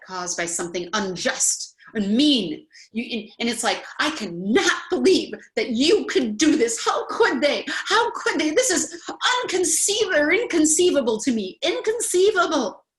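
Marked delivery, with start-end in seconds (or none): 4.0-12.9 high, loud, disbelieving outrage with a laugh; woman demonstrating indignation, high and loud with a half laugh; indignant outrage. Shown as a demonstration. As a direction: act out shocked indignation at being wronged. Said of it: "That's indignation".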